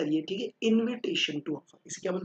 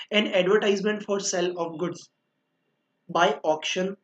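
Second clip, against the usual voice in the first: slow dictation; much slower than usual. Shown as a demonstration. Dictating the definition from the book.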